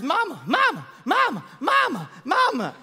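put-on high nagging kid calling mama; repeated kid calls of mama; whining kid. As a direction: call mama like a pestering child.

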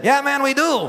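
high-pitched holler back over the water; yelled reply. Retold or imitated. Trader answering across the Rio Grande, high yell. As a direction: imitate someone calling back from far off. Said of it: "I screamed into Mexico".

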